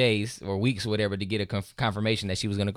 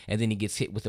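normal talking voice

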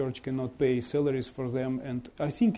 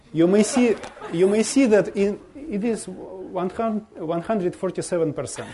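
laughing; 6 semitones higher, 4 dB louder than usual. Real, not acted. Joke about 147 percent turnout.

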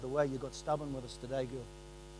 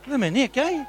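mock exasperation; 10 semitones higher, 13 dB louder than usual. Joking complaint to Nick.

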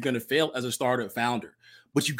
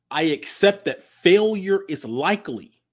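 pledge recital; 3 dB louder, slower than usual. Acted.

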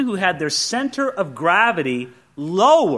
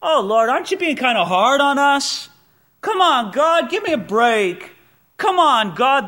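higher, louder mock whine at God; hammed-up whiny complaint to God; complaining prayer; 7 semitones higher, 5 dB louder than usual. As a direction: nag God for a break. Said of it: "to complain to God".

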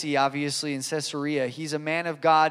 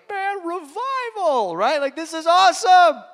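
imitando empolgação; 10 semitones higher, 4 dB louder than usual. Retold or imitated. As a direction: relive your thrilled reaction, pitched way up and stretched out. Excitedly recalling his own reaction.

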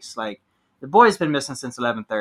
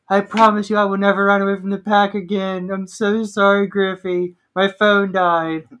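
tearful friend; 6 semitones higher, 5 dB louder than usual. Retold or imitated. Tearful apology voiced in story.

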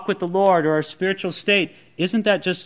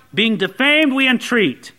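ordinary talk, nothing marked

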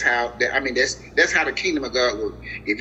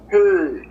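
Heard punched out louder and held; stressed word; 4 semitones higher, 6 dB louder than usual. Shown as a demonstration. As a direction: hit Heard hard so they underline it. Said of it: "underline that word".

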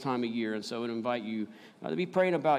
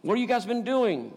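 berating; 8 semitones higher, 3 dB louder than usual. Retold or imitated. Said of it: "he doesn't come like that".

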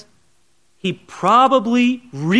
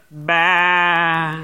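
sheep bleat; 2 semitones lower than usual. Retold or imitated. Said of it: "in the background you can hear".

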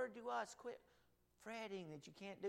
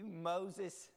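ordinary talk, nothing marked

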